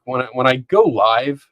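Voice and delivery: ordinary talk, nothing marked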